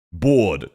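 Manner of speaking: "Bored" said like "board", with no R; Australian "Bored". Shown as a demonstration. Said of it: "we pronounce it more like it's a board".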